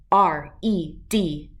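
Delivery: slow letter by letter; spelled out. Shown as a demonstration. Teaching a dog to spell, letter by letter slowly.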